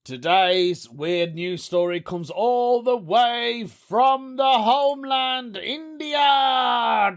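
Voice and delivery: high, slow, hammed-up announcer voice; segment announcer. Vlogger announcing the weird news segment, slow high showman voice. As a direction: draw it out like a show intro.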